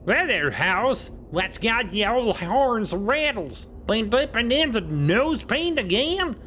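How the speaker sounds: a folksy frontier codger ribbing a friend; old cowboy voice. Acted. Voicing Gabby the cowboy.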